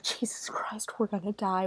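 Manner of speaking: breathless whisper of dread; scared whisper. Retold or imitated. Viewer whispering in horror at the screen. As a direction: whisper it like doom is coming. Terrified reaction while watching.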